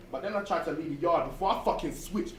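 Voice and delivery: usual speaking voice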